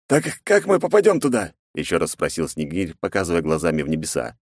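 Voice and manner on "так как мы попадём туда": voiced companion, pitched well up; high companion voice. A narrator's reading. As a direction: play the wanderer's friend, higher. Snegir asks, high character voice.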